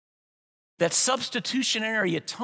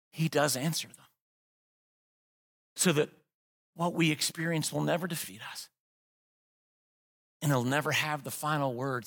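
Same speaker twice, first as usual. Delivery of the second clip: lower, softer, intimate preaching; quiet reflective preaching; 6 semitones lower, 4 dB quieter than usual. Real, not acted. Pastor confessing his own defiance to the congregation, quiet and sincere. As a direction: bring it down to a confession.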